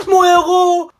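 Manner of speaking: loud, high, swooning cheer; hammed-up gushing shout; adoring cry. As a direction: cry out in overwhelmed admiration. Rescued, hails the hero.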